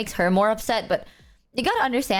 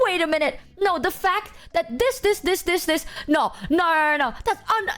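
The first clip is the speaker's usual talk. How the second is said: high, mock-indignant sputtering; imitated escalating anger; 7 semitones higher than usual. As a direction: pile up the objections, pitched high. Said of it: "anger herself more by going like".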